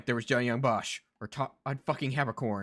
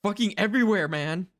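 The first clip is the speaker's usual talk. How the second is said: high, loud frustrated ranting; exasperated rant; 7 semitones higher, 5 dB louder than usual. Real, not acted. Streamer rants at ubiquitous voice actor, loud and exasperated. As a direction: rail at the voice actor who is everywhere.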